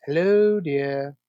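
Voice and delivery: stretched-out, teasingly grand hello; mocking mother impression. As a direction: imitate a formal old Hollywood greeting. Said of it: "the inside joke that she teaches the bird".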